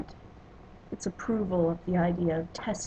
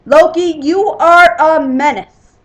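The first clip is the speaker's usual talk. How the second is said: bellowing; 7 semitones higher, 18 dB louder than usual. A narrator's reading. Thor accuses the trickster across the hall, bellowing. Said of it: "Thor rose from across the hall and bellowed".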